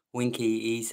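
normal talking voice

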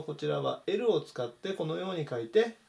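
ordinary talk

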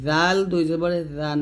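জাল as a light voiced th, tongue at upper teeth; thin dhāl. Shown as a demonstration. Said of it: "তবে জাল পাতলা হবে". (জাল পাতলা করে)

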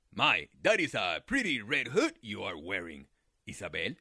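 low, sly coyote voice; a hungry coyote playing innocent; deep sly coyote.

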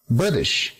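"British" stressed on BRIT; first-syllable stress. Shown as a demonstration. Teacher modelling word stress, "British" accented on first syllable. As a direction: lean on the first syllable of "British", let it fall. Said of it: "primera sílaba".

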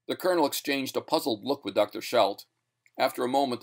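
ordinary delivery, nothing marked

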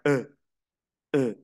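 "E" as French "eu", a dull "uh"-like vowel; the French lone "E", short and blank-sounding; silly E. Shown as a demonstration. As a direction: demonstrate "E" as a short rounded "ö". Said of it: "Yo le llamo una E boba".

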